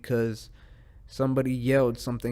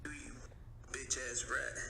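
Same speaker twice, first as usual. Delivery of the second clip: much softer, muttered insult; muttered taunt; 14 dB quieter than usual. Real, not acted. Podcaster muttering at a rat, hushed. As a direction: mutter it under your breath.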